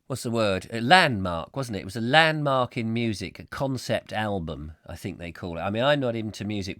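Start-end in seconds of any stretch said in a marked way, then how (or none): none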